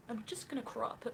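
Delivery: first go at a mocking high voice; mocking impersonation. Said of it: "I can't do it anymore".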